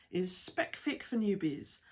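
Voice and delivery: normal talking voice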